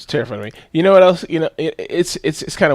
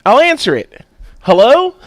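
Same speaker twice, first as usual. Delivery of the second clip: put-on little girl answering; 9 semitones higher, 7 dB louder than usual. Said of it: "She goes".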